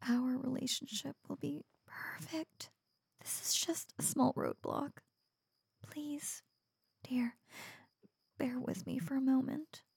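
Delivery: soft pleading whisper. Acted. Pleading intimately with reluctant boyfriend.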